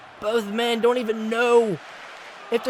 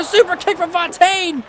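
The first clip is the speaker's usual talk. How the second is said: high, urgent big-move call; excited play-by-play call; 7 semitones higher, 3 dB louder than usual. Real, not acted. Commentator calling Fontaine's super kick live, excited shout. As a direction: call the kick as a sudden shock.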